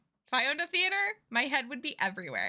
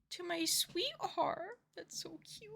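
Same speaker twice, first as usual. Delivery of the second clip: whisper; 7 semitones higher, 7 dB quieter, slower than usual. Real, not acted. Gushing over a game line.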